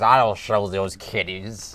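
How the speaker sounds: slow, drawn-out pirate talk. Retold or imitated. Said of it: "the guy talks like a pirate".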